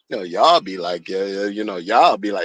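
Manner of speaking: low mock impression of a poser; mocking imitation. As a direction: mimic him with a dropped, sneering tone. Mocking how the guy talks.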